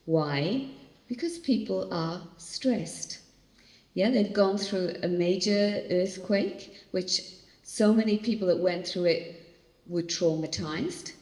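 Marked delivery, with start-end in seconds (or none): none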